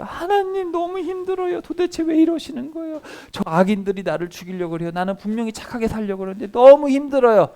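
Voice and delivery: crying out to God, exhausted; acted lament. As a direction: plead with God like a worn-out sufferer. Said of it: "이런 탄식".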